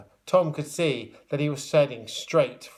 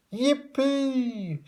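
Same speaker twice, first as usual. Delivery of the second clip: joyful shout; 12 semitones higher than usual. A narrator's reading. Old lady whooping as she flies off. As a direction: shriek it with glee as she flies. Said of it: "shouted the old lady with joy".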